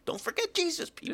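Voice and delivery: put-on high, soft Cuban accent quote. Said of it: "he is telling them".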